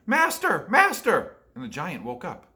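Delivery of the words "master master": high, loud, urgent cry; harp crying out. A narrator's reading. Magic harp crying to its master. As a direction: call out in alarm to wake him. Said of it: "the harp cried".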